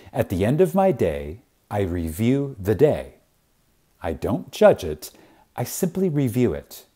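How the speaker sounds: ordinary talk, nothing marked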